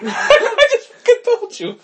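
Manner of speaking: high, slow, cracking with laughter; real laughing frustration; laughing exasperation. Real, not acted. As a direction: laugh it out in disbelief.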